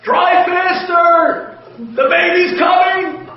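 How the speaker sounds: high, loud, slow screamed plea; screaming wife. Retold or imitated. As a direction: yell it from the back seat in labor. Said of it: "she's screaming from the back".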